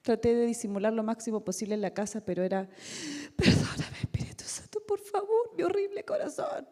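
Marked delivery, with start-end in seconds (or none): 3.3-6.7 whispered then rising to a high broken plea; retold weeping prayer, whisper turning much higher; tearful prayer. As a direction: pray it as if sobbing in secret. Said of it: "yo estaba llorando mucho".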